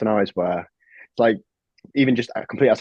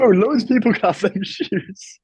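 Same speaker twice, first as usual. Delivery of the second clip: mock indignation; 10 semitones higher, slower than usual. Real, not acted. Mocking agreement, high slow.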